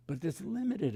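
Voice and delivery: normal talking voice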